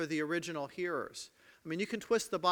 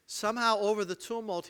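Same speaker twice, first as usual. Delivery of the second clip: ordinary talk, nothing marked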